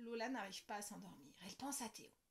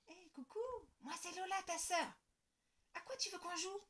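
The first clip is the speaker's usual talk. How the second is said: sweet greeting; 7 semitones higher than usual. Acted. Girl greeting baby brother.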